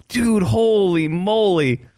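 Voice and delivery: high, slow, drawn-out holy moly; mock amazement. A viewer reacting to the injury, high slow mock awe. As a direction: stretch it out like you can't believe it.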